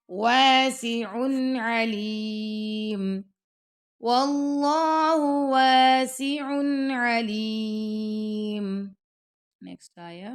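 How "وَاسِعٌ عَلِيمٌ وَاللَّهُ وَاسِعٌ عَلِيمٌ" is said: slow, measured tajweed chant; Quran recitation. Shown as a demonstration. Teacher reciting the verse to students, slow and melodic. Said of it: "یہاں پہ آپ کریں گے اظہار".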